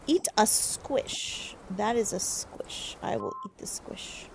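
slow, soft, whispery delivery; quiet, mock-solemn near whisper; hushed declaration. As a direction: say it slowly under your breath. Deadpan reading of game action.

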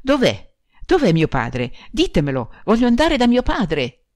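a grieving bride crying out for her dead father; frantic. A narrator's reading. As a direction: demand it wildly, as if losing her mind. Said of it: "come impazzita".

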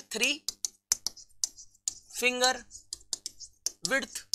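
Three, finger, width softly, with long pauses between; word-by-word dictation. Shown as a demonstration. Teacher dictating the gap rule to students, halting word-by-word dictation. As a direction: set three, finger and width apart, quietly, as if writing each.

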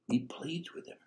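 breathy hushed whisper. Real, not acted. Preacher to congregation, hushed whisper. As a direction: drop to a whisper.

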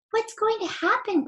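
put-on a child frightened on a runaway ride; alarmed cry. Joe on the runaway roundabout, high frightened cry. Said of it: "cried Joe".

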